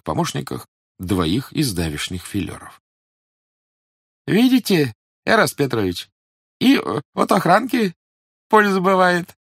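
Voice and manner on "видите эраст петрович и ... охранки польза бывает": high, sly, good-natured police agent; chummy and pleased with himself. A narrator's reading. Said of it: "раздался из темноты добродушный голос".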